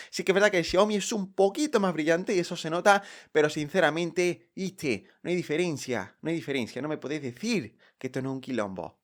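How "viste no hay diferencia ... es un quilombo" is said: put-on soft, offhand Argentine accent; quiet mock Argentine accent. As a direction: slip into a joking Argentine voice, quieter. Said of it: "perdón por el argentino".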